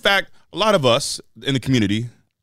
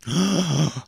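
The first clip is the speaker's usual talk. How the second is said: mock gasp; 4 semitones higher than usual. Shocked at the name.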